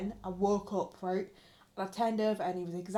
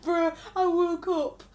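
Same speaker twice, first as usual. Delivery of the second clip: excited; 6 semitones higher, 7 dB louder, slower than usual. Real, not acted. Excitedly starting her story.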